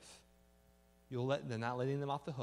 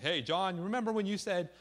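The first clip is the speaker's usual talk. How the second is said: loud, high-pitched confrontation; blurting a correction for all to hear; loud public call-out; 7 semitones higher, 9 dB louder than usual. Acted. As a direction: call someone out loudly in front of everyone. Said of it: "Don't be like".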